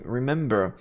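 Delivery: usual speaking voice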